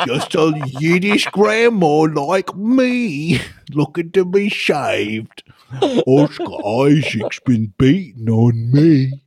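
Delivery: very slow, slurred mock-accented granny; Yiddish grandmother. Co-host as Yiddish grandma robot, slow hammed-up pleading. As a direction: drag every word out in a botched accent. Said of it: "I'm your Yiddish grandmother".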